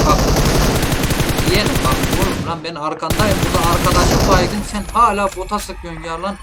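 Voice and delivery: loud gloating; loud taunt. Real, not acted. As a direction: gloat loudly from behind him. Taunting enemy behind him.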